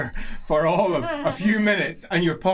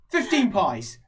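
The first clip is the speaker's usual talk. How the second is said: halfling merchant; much slower than usual. Acted. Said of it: "He says".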